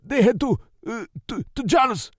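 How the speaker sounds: high, stammering, frightened reply; panicked answer. A narrator's reading. Captive stammering the answer under the gun. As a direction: get the words out in terror.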